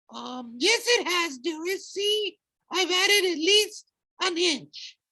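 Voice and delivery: high squeaky falsetto. A narrator's reading. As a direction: squeak it out in a fake girly voice. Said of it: "falsetto voice".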